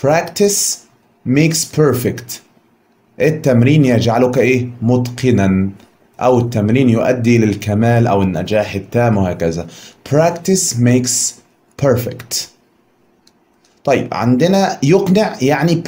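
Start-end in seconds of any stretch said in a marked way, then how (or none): 0.1-2.3 practice makes perfect, drawn out word by word; slow, deliberate teacher recitation; slow recited proverb. Shown as a demonstration. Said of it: "عندنا حكمة بتقول". 10.1-12.4 slow model repetition; slow recited proverb. Shown as a demonstration. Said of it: "عندنا حكمة بتقول".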